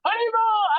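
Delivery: high-pitched call from a window; imitated calling out. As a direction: call out the greeting, mimicking someone.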